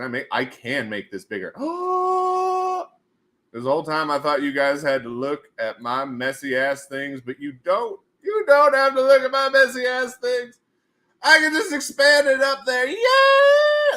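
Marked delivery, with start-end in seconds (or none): 1.6-2.7 long, high theatrical gasp; drawn-out mock-amazed gasp; delighted gasp. Delight at bigger screen. 8.2-14.0 high, loud sing-song glee; hammed-up triumphant delight; gleeful celebration. Celebrating the expandable screen.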